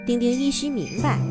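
ordinary delivery

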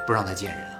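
usual speaking voice

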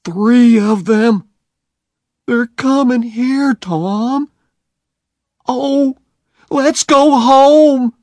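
put-on a scared boy begging to leave; terrified Huck. Huck pleading with Tom to go home, high and scared.

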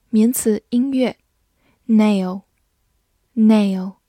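ordinary delivery

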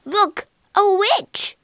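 high, loud cry of warning; alarmed shout. A narrator's reading. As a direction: shout the alarm at seeing a witch. Alarmed cry on spotting the witch.

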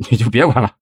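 impatient, emphatic retort; testy. A narrator's reading. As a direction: shut her questions down irritably. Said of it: "没好气儿".

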